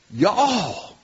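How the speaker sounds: long Southern y'all; Southern drawl. Acted. Preacher voicing Paul's correction to believers, Southern drawl. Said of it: "It's with a drawl".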